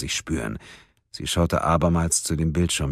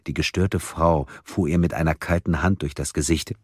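ordinary delivery

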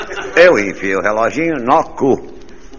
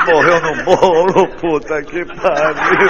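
laughing punchline; 7 semitones higher, much slower than usual. Real, not acted. Punchline laughing while telling.

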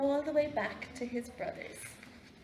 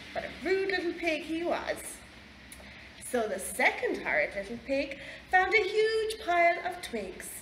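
ordinary delivery, nothing marked

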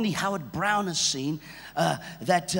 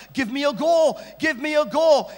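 pestering plea; 6 semitones higher, 7 dB louder than usual. Retold or imitated. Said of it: "He pestered his pastor".

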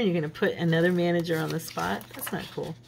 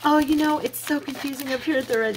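higher imitation of the embarrassed cashier; flustered cashier excuse; 9 semitones higher than usual. Cashier explaining her mix-up to the customer, high and sheepish. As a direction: play her up as sheepish and apologetic. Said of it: "And she, of course, is like".

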